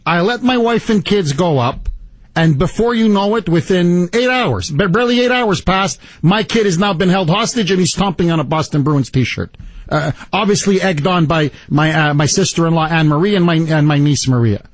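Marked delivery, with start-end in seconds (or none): none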